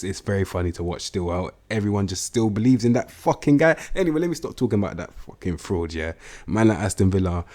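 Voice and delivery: usual speaking voice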